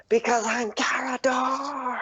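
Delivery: high, drawn-out mock proclamation; shouted boast. Bullywug shouts out who he is to Sin, high boast. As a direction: proclaim the name in a high cry. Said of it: "he shouts out".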